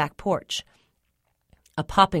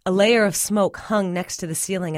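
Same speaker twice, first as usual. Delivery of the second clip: ordinary delivery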